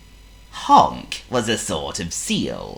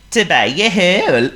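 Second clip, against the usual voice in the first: Honk the seal; 12 semitones higher, 8 dB louder than usual. Acted. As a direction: play a polite silly seal. Honk's character voice.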